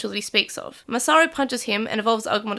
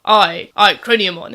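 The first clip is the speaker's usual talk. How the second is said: booming knightly self-announcement; Craniumon voice; 3 semitones lower, 8 dB louder, slower than usual. Retold or imitated. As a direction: proclaim your own name grandly. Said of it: "It's very Royal Knighty".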